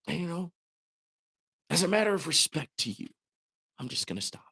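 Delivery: softly, with pauses; mock obliging quiet. Streamer pretending to obey a viewer's complaint, hushed. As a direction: feign hushed respect before breaking the promise. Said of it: "since you asked me to stop hollering".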